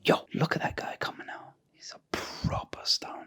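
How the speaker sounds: breathy whisper, barely voiced; whispered aside. Friend [name] whispering about the stoner coming out of the house. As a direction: hiss it to your mate so he can't hear. Friend points out guy quietly.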